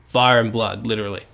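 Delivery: ordinary talk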